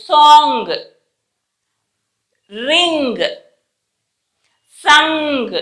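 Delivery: సాంగ్, రింగ్, సంగ్ each drawn out on "ng"; సాంగ్, రింగ్, సంగ్ as spaced demo words; NG endings. Shown as a demonstration. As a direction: isolate each word, holding its nasal "ng" before the g. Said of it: "వచ్చింది చూసారా".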